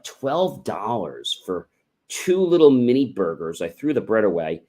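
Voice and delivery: normal talking voice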